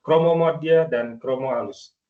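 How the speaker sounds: normal talking voice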